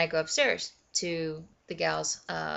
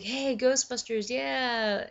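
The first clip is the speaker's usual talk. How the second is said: higher-pitched mock cheer; imitated enthusiastic boss; 7 semitones higher, slower than usual. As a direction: mimic an excited greeting. Voicing enthusiastic number two guy.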